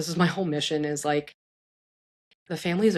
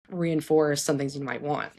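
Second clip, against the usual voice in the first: normal talking voice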